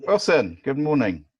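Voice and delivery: loud call across a bad line. Real, not acted. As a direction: raise your voice so he hears. Calling to unhearing participant.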